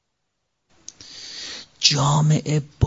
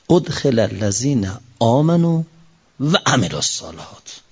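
Quran recitation; 5 semitones lower than usual. Acted. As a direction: intone it like scripture.

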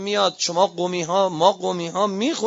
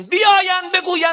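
much higher, louder repeat; indignant shout; 13 semitones higher, 7 dB louder than usual. Real, not acted. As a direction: burst into a high pulpit shout. Same words repeated as shout.

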